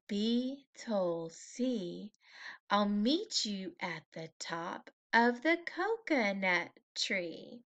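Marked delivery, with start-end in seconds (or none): none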